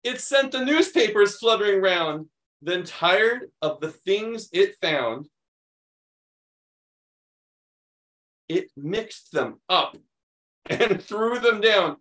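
animated picture-book reading; reading to children. Acted. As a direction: read it like story time for kids. Reads picture book aloud.

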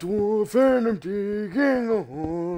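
high, slow dwarf voice. Pretend. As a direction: play a jolly dwarf digging. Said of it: "I'm a dwarf".

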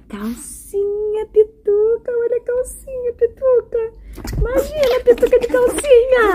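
high cooing pet voice. Real, not acted. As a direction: coo at the little dog. Cooing at dog Pituca.

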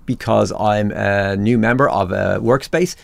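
usual speaking voice

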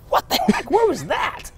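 high stunned gasp as the key appears; stunned disbelief. Retold or imitated. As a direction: gasp it, then squeak out the question. Speaker's stunned reaction to the key.

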